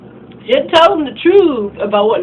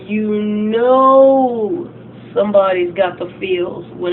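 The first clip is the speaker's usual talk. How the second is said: ordinary delivery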